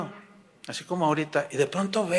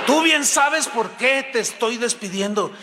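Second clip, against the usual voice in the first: higher-pitched mock scolding; angry boss; 7 semitones higher than usual. Boss dismissing an accused employee, acted anger. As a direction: fire them with acted outrage.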